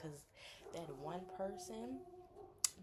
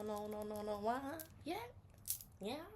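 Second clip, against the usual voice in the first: ordinary talk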